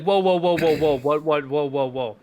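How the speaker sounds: panicked whoa. Retold or imitated. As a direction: stammer out the whoas in a rising panic that winds down. Panicked reaction, retold.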